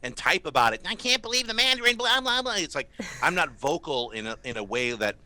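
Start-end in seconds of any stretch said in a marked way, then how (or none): 0.9-2.6 high, whiny fanboy voice; mocking whiny fan. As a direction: whine it like an outraged fanboy. Mocking an upset online fan.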